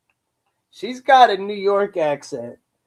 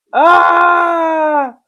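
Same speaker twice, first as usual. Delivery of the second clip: shriek; 9 semitones higher, 6 dB louder than usual. Real, not acted. Reacting to video.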